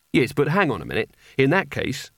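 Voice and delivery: usual speaking voice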